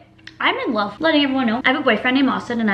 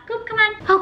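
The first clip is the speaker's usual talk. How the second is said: calling dog; 11 semitones higher than usual. Real, not acted.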